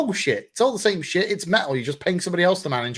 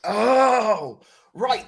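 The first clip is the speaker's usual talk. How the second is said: surprised oh; 8 semitones higher, 3 dB louder than usual. Real, not acted. Reacting to the DHH comment.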